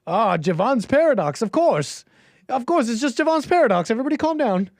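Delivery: a sweating CEO insisting everyone calm down; nervous sweating voice. Retold or imitated. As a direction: play it cool while clearly panicking. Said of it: "some are taking it as a sweating, as a nervousness".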